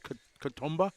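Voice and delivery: hesitant try at "Katoomba", stumbling on the K; unsure pronunciation. Real, not acted. Announcer struggling with a local place name, hesitant and unsure. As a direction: stammer the first sound of "Katoomba", then get it out tentatively. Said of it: "I'm gonna struggle with that".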